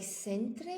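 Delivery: "centre" said as spelt, ending in -tray; mispronounced centre. Shown as a demonstration. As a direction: say "centre" wrongly with a sounded -re. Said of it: "people sa-, try to say".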